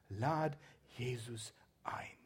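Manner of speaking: slow, breathy whisper; whispered plea. Acted. Preacher inviting listeners to Jesus in a slow whisper. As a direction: invite softly, almost a secret.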